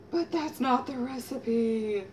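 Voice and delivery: mock gasp. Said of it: "I'm like".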